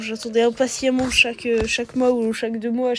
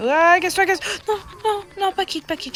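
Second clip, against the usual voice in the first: frantic yelling; 8 semitones higher than usual. Real, not acted. Frantic during game fight.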